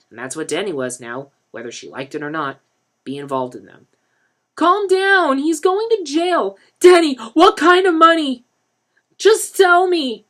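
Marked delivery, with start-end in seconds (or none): 4.6-6.6 calming. A narrator's reading. Denny calms the women. 6.8-10.2 much higher, louder, slow plea; desperate. A narrator's reading. Lisa begs for answers.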